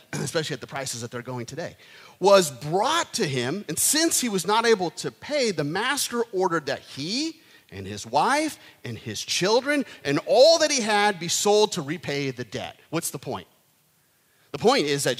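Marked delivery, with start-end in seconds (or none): 2.2-12.8 rising, louder scripture reading. Acted. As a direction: build the passage with force.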